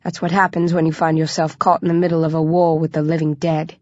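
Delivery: plain reading voice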